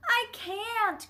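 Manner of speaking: high-pitched helpless cry. A narrator's reading. Neighbor answers the crowd, crying out helplessly. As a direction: cry out in a fluster. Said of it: "cried the new neighbor".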